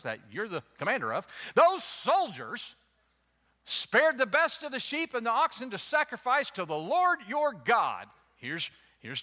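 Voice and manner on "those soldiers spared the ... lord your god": Saul shifting blame. Acted. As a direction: push the blame onto the troops. Saul voiced blaming the soldiers.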